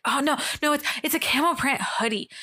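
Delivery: lightly imitating breathless speech; mock breathless correction; out of breath. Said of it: "she just sounds out of breath".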